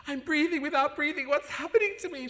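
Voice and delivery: high, loud bewildered voice; amazed. Retold or imitated. Telling the praying friend, high and astonished. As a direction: gasp out the wonder. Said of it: "I said to her".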